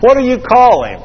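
usual speaking voice

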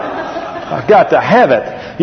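slow, drawn-out I've got to have it through a laugh; mock longing, chuckling; slow laughing craving. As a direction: savour it like a greedy child. Mocking his own craving.

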